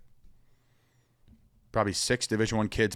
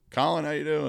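put-on Southern accent; 8 semitones higher, 3 dB louder than usual. Southern college coach greeting a recruit on the phone, high Southern accent. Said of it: "In a Southern accent".

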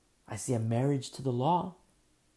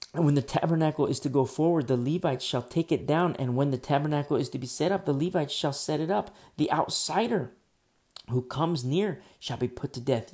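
loud, proclaiming scripture reading; preaching at full force; 7 dB louder than usual. Real, not acted. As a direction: declaim the verses like a preacher on fire.

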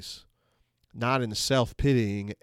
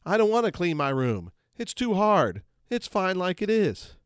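high, sulky whine; whining child; 6 semitones higher than usual. Retold or imitated. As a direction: whine like a kid told to tidy up. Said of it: "in a whining voice says".

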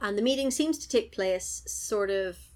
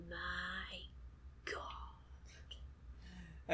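slow, drawn-out whisper; hushed exclamation; 3 semitones lower, 17 dB quieter than usual. Retold or imitated. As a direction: whisper it in mock awe, very slowly. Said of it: "English dude went".

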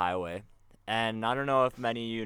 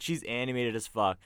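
ordinary delivery

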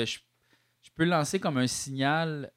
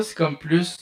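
ordinary delivery

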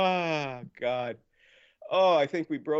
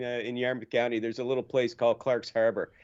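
ordinary talk

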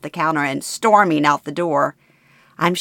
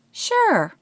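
put-on much higher, light old mother; casual mother. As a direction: toss it off lightly.

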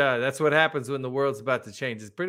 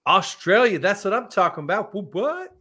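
put-on high, playful Aussie accent; mock Australian, pitched up; 7 semitones higher, 3 dB louder than usual. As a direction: ham up an Australian accent, lifting the question. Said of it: "an accent that's not mine".

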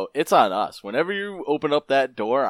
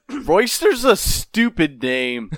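slow, higher, louder heckle; mock yelled taunt; 7 semitones higher, 6 dB louder, much slower than usual. Manny heckling Royster after his grand speech, yelling. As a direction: yell a childish insult across the battle. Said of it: "Manny takes a free action to yell out".

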